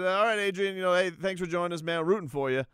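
a touch of put-on interviewer cheer; insincere journalist voice. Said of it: "my journalist voice".

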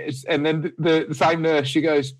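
normal talking voice

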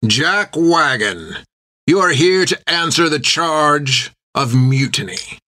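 slow, menacing ship captain; a hint of a cold captain; stern captain. A narrator's reading.

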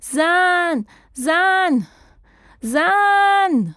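high, drawn-out calls; long searching cry; calling out. A narrator's reading. Frantically calling for lost companion.

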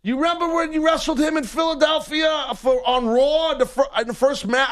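high eager fan voice; the shopping cart guy gushing at the wrestler, high; excited fan. Retold or imitated. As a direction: gush like an overexcited superfan. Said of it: "he's following me talking".

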